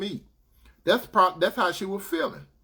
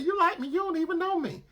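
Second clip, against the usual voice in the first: high teasing woman; 12 semitones higher than usual. Retold or imitated. Woman teasing him back.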